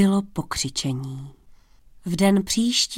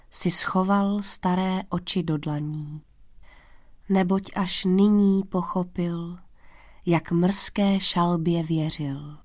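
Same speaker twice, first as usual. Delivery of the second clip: usual speaking voice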